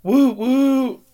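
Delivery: high hooting train whistle; train whistle sound. Pretend. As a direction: hoot it out like a toy train. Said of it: "The train sound".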